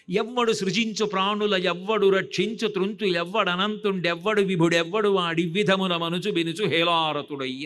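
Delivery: forceful, rhythmic Telugu padyam; verse recitation. Acted. Consoling a weeping mother with scripture, verse recitation. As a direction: declaim the verse in one driving run.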